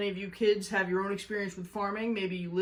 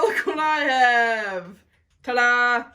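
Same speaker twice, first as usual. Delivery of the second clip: louder, slow showy reveal; proud reveal; 3 semitones higher, 7 dB louder, slower than usual. Real, not acted. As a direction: present it like a magician. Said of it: "revealing the money tree".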